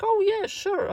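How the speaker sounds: high eager oh yeah sure; happily accepting tea from guests; delighted yes. Retold or imitated. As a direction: jump at the offer of tea.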